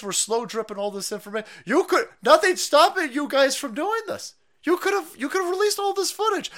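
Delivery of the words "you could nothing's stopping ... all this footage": high, loud exasperated rant; heated rant. Real, not acted. Ranting at the January sixth committee, heated and higher. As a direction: hammer it at them, exasperated.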